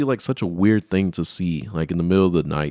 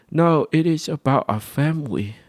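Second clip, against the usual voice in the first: higher, mocking family voice; mock parent voice; 7 semitones higher than usual. Kid mimicking parents about the birthday, mocking. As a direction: parody the parents' answer.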